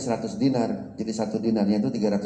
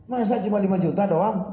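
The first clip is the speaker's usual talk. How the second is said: high, pleading protest from the tailor; the tailor cries out that the work took him ages; indignant tailor; 8 semitones higher, 3 dB louder than usual. Acted. Tailor protesting to the buyer, high and aggrieved.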